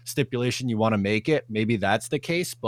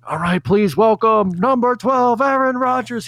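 stadium announcer; 10 semitones higher, 9 dB louder than usual. Retold or imitated. Stadium announcer introducing Rodgers.

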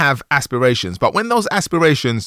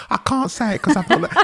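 higher mock imitation of her words, laughing; mocking quote; 12 semitones higher than usual. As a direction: tease her by echoing her.

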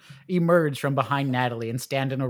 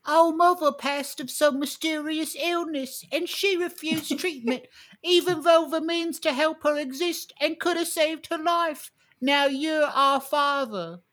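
put-on high, slow child voice; 13 semitones higher than usual. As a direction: speak as a solemn little kid. Twelve children speak in chorus.

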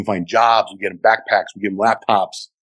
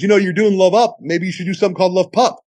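woman caller; 9 semitones higher than usual. Retold or imitated. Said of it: "this woman calls up".

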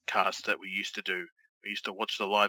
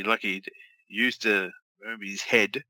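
ordinary talk, nothing marked

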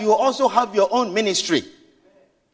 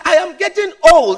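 put-on I am getting old, loud and high; louder plea; 4 semitones higher, 6 dB louder than usual. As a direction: cry out old. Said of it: "repeated plea".

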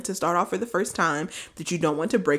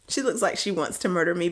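usual speaking voice

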